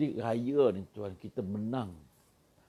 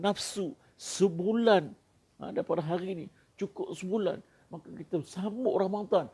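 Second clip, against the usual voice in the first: raised, emphatic sermon voice, slowing on key words; fervent preaching; 8 semitones higher, 3 dB louder than usual. Acted. Preacher rousing the congregation about Eid victory, fervent and raised.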